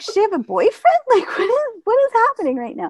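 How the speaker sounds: high, laughing disbelief; baffled inner voice. Retold or imitated. Narrator reacting to grandma's new friend, laughing disbelief. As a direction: act out the shock with a laugh. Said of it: "And I'm thinking, like".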